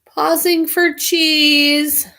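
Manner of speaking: very slow, drawn-out words; slow announcement. Acted. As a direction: stretch each word like an announcer.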